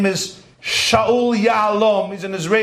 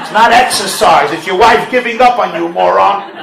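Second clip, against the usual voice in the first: mock scolding shout; 8 dB louder than usual. Mocking retort to husband.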